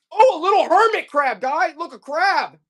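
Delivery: very high, loud squeal of delight; delighted discovery. Real, not acted. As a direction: exclaim over a cute little find. Seller delighted to find crab figure.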